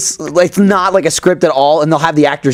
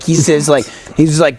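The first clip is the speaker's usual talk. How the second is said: normal talking voice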